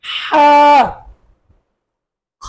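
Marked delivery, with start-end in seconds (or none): 0.0-0.5 হা pressed from the throat, a tight breathy h; throaty ha. Shown as a demonstration. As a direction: squeeze হা from the throat. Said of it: "গলায় চাপ দিয়ে তারপর আদায় করতে হবে". (গলায় চাপ দিয়ে হা)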